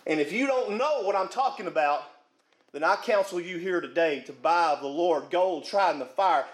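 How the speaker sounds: normal talking voice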